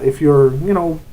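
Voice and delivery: usual speaking voice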